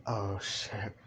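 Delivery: low, very soft oh-shit; quiet dread. Acted. Bakugo realizing Inko heard him, hushed dread. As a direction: say it tiny, under your breath. Bakugo sees he's in trouble.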